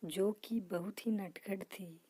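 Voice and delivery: soft whispered storytelling; secretive whisper to start the tale; whispered opening. Acted. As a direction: draw them in with a hush.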